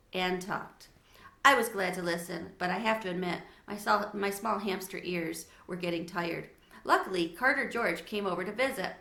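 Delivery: plain reading voice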